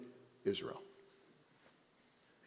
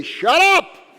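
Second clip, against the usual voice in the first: loud, high shout with a laugh; yelling at God; 12 dB louder than usual. Acted. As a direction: yell up at the heavens. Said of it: "They yell at God".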